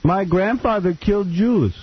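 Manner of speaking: slow, high mock German accent; German accent, played for laughs. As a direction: ham up a German girl's confession. Mocking German woman's voice.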